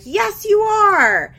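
imitating Janelle shouting at grandma on the phone; mock shouted insistence. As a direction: yell it slow like a bratty demand. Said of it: "Janelle's like".

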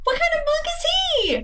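high incredulous question; disbelieving squeak. Real, not acted. Surprised at sunburst kick.

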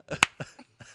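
snorting laugh through the nose. Real, not acted. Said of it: "Did you just snort?".

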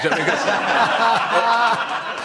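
hammed-up Geordie twang on a slow line; mock Geordie accent. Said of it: "you can see him trying to do the Geordie accent".

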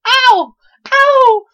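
very high, loud yelps; mock pain shriek. Old lady claiming a dog attack, hammed-up shriek. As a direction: howl it in hammed-up agony. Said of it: "all of a sudden she goes".

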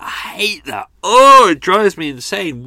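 high, loud exasperated outburst. Real, not acted. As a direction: blow up in comic frustration. Ranting about bad sound effects.

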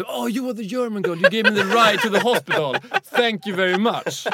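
light, polite grateful voice; polite thanks. Retold or imitated. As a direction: thank her sweetly in English. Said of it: "och jag sa lite".

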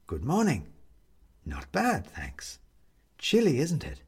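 plain reading voice

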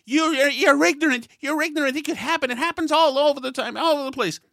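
hammed-up outraged stranger; indignant woman. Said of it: "She said".

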